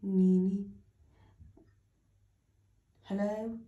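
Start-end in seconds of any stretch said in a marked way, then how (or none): none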